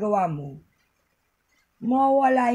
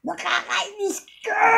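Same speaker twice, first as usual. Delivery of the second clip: demo frustrated yell; 10 semitones higher than usual. Shown as a demonstration. Vlogger demonstrating a hiccup-cure scream to viewers, high crying shout. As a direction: scream it out to the max, voice cracking. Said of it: "sisigaw ka. Malakas na malakas".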